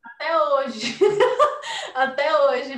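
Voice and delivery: loud laugh into words; laughing hard while speaking. Real, not acted. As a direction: burst out laughing at the memory. Laughing about old online crush.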